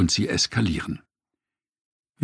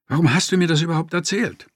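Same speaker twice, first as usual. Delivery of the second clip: quick, higher-pitched annoyed question; understated irritation, rushed and raised in pitch; annoyed reproach; 7 semitones higher, faster than usual. A narrator's reading. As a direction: fire off a mildly exasperated reproach. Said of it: "wurde er ärgerlich".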